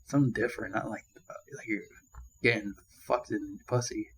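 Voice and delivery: ordinary talk, nothing marked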